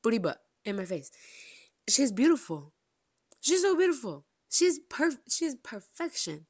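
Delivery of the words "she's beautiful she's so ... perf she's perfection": high, loud, gushing over her idol; squealing gush. Real, not acted. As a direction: let it burst out in a squeal.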